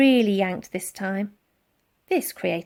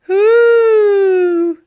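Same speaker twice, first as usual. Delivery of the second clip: high, loud, strained mammoth hoot; a big beast grunting as it falls; mammoth groan; 11 semitones higher, 14 dB louder than usual. A narrator's reading. Mammoth pushed up.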